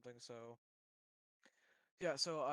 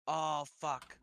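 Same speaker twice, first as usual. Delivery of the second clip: sudden loud frustrated swear; frustrated curse; 3 semitones higher, 14 dB louder than usual. Real, not acted. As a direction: blurt it out in annoyance. Realizes the mod costs more.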